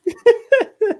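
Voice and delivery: sudden high laugh; high burst of laughter. Real, not acted. Reacting to a co-host's joke with a sudden high laugh.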